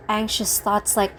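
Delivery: ordinary talk, nothing marked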